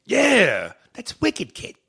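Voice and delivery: loud, high mock Bostonian; hammed-up Boston accent. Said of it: "only place that says wicked".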